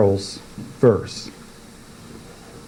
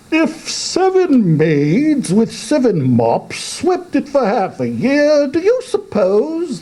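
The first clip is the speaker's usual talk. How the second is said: much higher, pompous questioning voice; walrus. Acted. Walrus asking the carpenter about clearing the sand, high. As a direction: ask grandly as the walrus. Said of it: "the walrus said".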